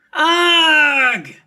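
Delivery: loud high exasperated "Ugh!"; frustrated groan. A narrator's reading. Avery groaning at Lucy's teasing.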